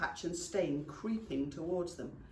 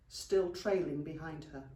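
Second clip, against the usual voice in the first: ordinary delivery, nothing marked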